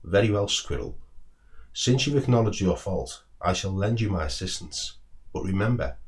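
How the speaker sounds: usual speaking voice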